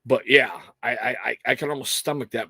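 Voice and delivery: ordinary talk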